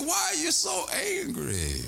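calm him with a kind question; gentle question. Retold or imitated.

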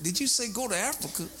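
can't believe what he just heard; disbelieving. Retold or imitated. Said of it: "I was like".

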